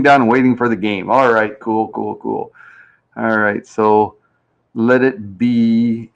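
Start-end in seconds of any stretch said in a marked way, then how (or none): none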